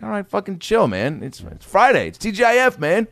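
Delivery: high, mock-indignant protest; exasperated. Neighbor telling strict dad to chill, exasperated.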